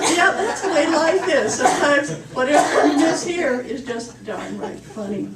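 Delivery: loud and high, words full of laughter; laughing while talking. Real, not acted. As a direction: talk through the tail of the laugh. Still laughing after joke.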